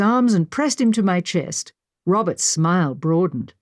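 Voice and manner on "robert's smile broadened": plain reading voice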